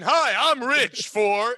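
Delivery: slow, loud pitchman voice; hammed-up TV ad announcer; mock commercial voice. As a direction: sell it like a cheesy ad.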